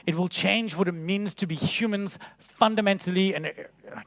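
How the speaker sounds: ordinary talk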